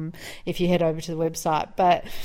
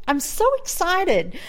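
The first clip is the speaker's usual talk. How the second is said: much higher, slow gushing; mock excitement; 12 semitones higher, 3 dB louder than usual. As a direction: ham up fake delight. Mocking excited Americans.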